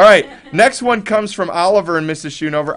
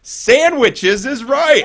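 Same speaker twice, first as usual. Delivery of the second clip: excited; 9 semitones higher, 5 dB louder, much slower than usual. Real, not acted. Punchline reveal, excited.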